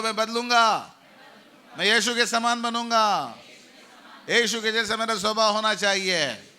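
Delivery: loud confident promise; loud declaration. Shown as a demonstration. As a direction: proclaim it with full conviction. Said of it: "बोलो".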